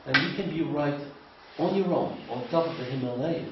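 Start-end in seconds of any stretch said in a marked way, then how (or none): none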